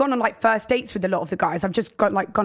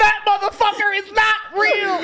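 mock shout; 10 semitones higher, slower than usual. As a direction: yell it as her, stretched out. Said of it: "she had to go".

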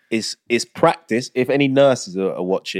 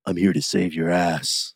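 low, put-on action-hero voice; mock a corny film line in a lowered voice; Jason Statham's weird voice; 5 semitones lower, 4 dB quieter than usual. Mocking a film actor's corny line, weird low voice. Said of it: "puts on this fucking weird voice".

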